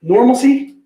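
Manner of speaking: Normalcy said nor-mul-see, rising; quizzing the coworker on normalcy, asking it with a rise. Shown as a demonstration. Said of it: "pronunciation debate".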